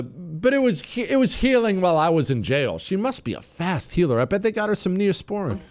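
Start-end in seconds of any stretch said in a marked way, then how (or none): 0.0-2.8 mock imitation. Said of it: "she throws in the".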